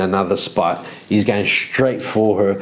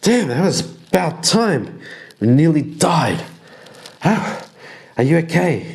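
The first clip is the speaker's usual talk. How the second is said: higher relieved Ymir; 6 semitones higher than usual. Acted. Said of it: "walks to her and says".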